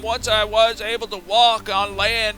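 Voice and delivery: put-on reminiscing southern old codger; old fogey. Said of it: "he's an old fogey".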